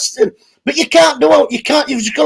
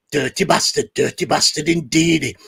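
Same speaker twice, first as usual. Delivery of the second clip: lower, much softer gloating voice; low mock growl; 8 semitones lower, 7 dB quieter than usual. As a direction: mutter it low like a villain.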